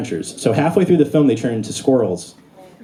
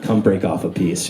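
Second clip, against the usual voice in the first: low seductive Nala; 6 semitones lower, 3 dB quieter than usual. Retold or imitated. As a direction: lower sultry come-on from Nala. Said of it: "She's like".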